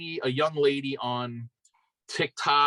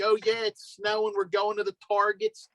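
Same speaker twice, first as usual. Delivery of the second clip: put-on higher-pitched thick Philly accent chatter; Philly accent impression; 5 semitones higher than usual. Guest imitates the online lady, thick Philly accent. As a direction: imitate a Philly lady rambling about errands. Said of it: "worst Philly accent".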